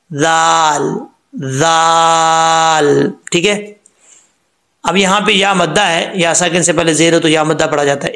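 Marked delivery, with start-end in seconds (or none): 1.3-3.1 लाल held long and clear, no whistle; recited lam. Shown as a demonstration. Teacher showing how to recite लाल without a whistle. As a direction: stretch लाल out slowly in recitation. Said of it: "कैसे?".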